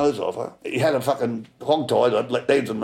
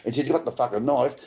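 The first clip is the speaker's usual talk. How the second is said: ordinary talk, nothing marked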